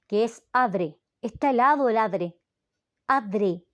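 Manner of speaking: "adre" with a D slipped in where aire has none; "Está helado el adre" said as country folk do; wrong "adre" for aire. Shown as a demonstration. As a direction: rural "adre", the D clearly sounded. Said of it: "una palabra que todavía se le escucha".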